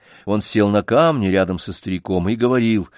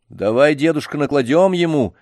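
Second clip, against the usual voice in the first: boldly; 7 semitones higher, 4 dB louder than usual. Acted. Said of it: "храбро предложил Сергей".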